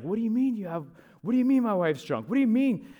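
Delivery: high-pitched retold indignation; mock outraged, repeating himself in disbelief; unhappy husband. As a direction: imitate a husband demanding an explanation. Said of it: "he was not happy".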